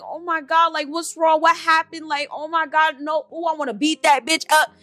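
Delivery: high, gushing worried friend; mock alarmed concern. To her crying best friend, high-pitched alarmed outrage. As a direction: rush in all fired up to defend him.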